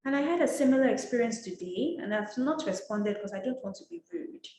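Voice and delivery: usual speaking voice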